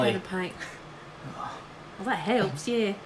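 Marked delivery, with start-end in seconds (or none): 0.0-0.5 Australian accent. Shown as a demonstration. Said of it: "get into the Australian accent".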